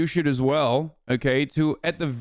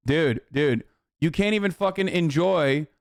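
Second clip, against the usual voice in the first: ordinary delivery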